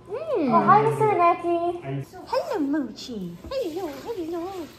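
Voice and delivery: high cooing voice for pets; pet talk voice. Real, not acted. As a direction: coo sweetly at the pets. Greeting pets at Lena's.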